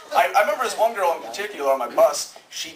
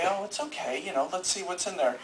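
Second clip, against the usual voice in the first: put-on soft, gentle reassurance to a baby; soothing dad; 3 semitones higher, 8 dB quieter than usual. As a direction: coo it like a calm father. Father soothing a crying baby.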